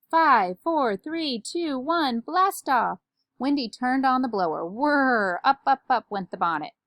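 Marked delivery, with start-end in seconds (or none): 0.1-3.0 high, ringing launch countdown. A narrator's reading. Amelia Bedelia counts down to her bonnet's launch, drawn-out countdown. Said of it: "Amelia Bedelia counted down". 4.6-5.4 louder, higher imitated motor whirr; blower whirr. A narrator's reading. Narrator voices Wendy's blower switching on, whirring.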